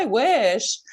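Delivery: put-on wistful hinting kid. Mom imitating her oldest son's hints, wistful. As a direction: imitate a child's swooping wish.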